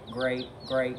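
ordinary delivery, nothing marked

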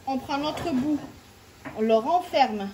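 usual speaking voice

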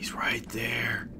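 slow, hushed whisper while sneaking; sneaky whisper. Real, not acted. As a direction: breathe it so he won't hear. Sneaking up on target.

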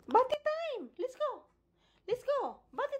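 very high singsong to the pet. Real, not acted. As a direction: owner coaxing dog to bath. Singsong to dog.

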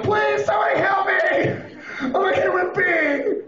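very high, hammed-up wail for help; desperate pleading. Stranded driver to passing cars, desperate wailing. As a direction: cry out desperately, stretching the words.

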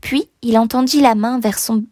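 ordinary delivery

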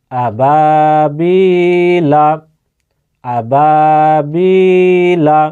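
slow, drawn-out chanted recitation; Quran recitation. Shown as a demonstration. Teacher chanting the word slowly for students in Quranic style. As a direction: stretch each vowel like a reciter. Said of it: "এবারে দুইটাকে Milan একসাথে".

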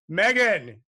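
loud bellowed name with a falling end; father's yell. A narrator's reading. Father calls his daughter from the breakfast table, loud yell. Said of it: "yelled her father".

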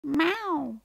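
high mewing miaou; cat meow. A narrator's reading. The fleeing cat to the girl, a high meow.

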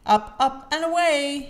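high, loud launch call; crying out. A narrator's reading. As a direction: call out to send the planes off. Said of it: "he cried".

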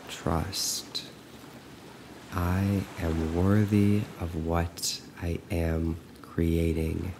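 soft, slow affirmations with long pauses; guided meditation. Acted. Coach leading listeners to repeat trust, softly and slowly. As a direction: let each phrase sink in, quiet and unhurried.

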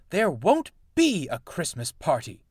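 grumpy engine snapping at Nancy. A narrator's reading. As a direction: bite the words off in irritation. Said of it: "he snapped".

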